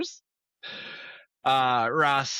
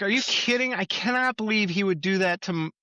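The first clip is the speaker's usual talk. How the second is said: high indignant disbelief; 8 semitones higher than usual. Retold or imitated. Rachel voiced, shocked disbelief.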